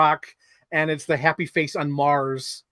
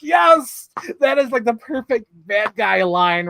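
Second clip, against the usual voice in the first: high, loud delighted burst; excited agreement; 6 semitones higher, 5 dB louder, slower than usual. Retold or imitated. A fan cheering the perfect bad guy line, excited. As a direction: gush over a perfect villain line.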